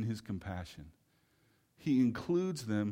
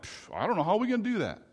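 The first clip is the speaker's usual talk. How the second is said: dismissive shrug; 9 semitones higher, 6 dB louder, faster than usual. Retold or imitated. Disciples shrug off feeding crowd.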